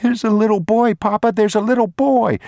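put-on high, loud child's voice, urgent; a small boy insisting to his papa; pleading boy. Boy begging his father to believe him, high and loud.

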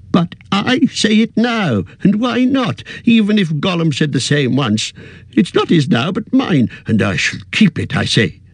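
put-on defiant old hobbit. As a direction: dig in your heels. Bilbo defiant.

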